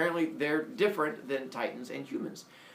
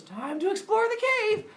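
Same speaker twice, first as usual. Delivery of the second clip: put-on very high eager baby voice; squeaky little baby ready to go; baby Hermes; 4 dB louder than usual.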